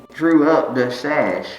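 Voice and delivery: ordinary talk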